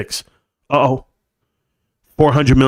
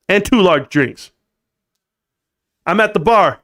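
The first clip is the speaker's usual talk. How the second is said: usual speaking voice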